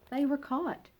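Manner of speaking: plain reading voice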